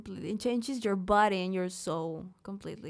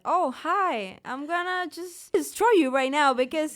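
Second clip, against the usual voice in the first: high, loud mock menace; anxiety's voice; 6 semitones higher, 7 dB louder than usual. Anxiety threatening its owner on stage, high loud mock menace. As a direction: voice anxiety as a loud, pushy intruder. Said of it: "My anxiety just said".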